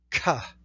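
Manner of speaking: "Ka" said as kha with a breathy puff after the k; aspirated kha. Shown as a demonstration. Teacher demonstrates the aspirated version of "Ka". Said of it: "we just add a little bit of extra breath".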